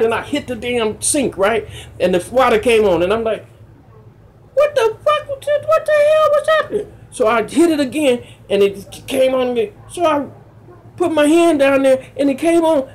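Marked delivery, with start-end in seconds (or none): 4.5-6.9 very high-pitched startled disbelief; mad, startled outburst. Retold or imitated. Man startled by water coming on by itself, high-pitched outburst. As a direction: shoot the voice way up in alarm. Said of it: "I get mad".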